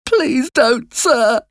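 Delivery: put-on very high, pleading child's voice; frightened cry. A narrator's reading. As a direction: plead desperately like a scared boy. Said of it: "I cried".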